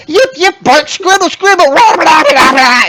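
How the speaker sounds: loud high yapping gibberish; high kobold yips and barks. Acted. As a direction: yap like a pack of little dogs. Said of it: "You just hear".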